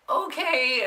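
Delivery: high, bright-pitched okay; chirpy okay. Retold or imitated. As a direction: cheerfully go along with it. Caregiver's chirpy reply.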